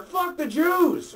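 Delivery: high, loud, drawn-out yell; slow shout. Retold or imitated. Kid yelling from the back of the class, loud and slow. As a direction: bellow it from the back.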